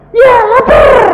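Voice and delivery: very high, loud shout; acted childish tantrum; like a small child. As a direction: yell it like an impatient hungry child. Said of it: "Kayak anak kecil nggak?".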